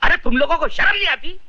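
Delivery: high-pitched outraged reproach; indignant scolding. Acted. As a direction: shame the mob for beating children. Bystander scolds the mob.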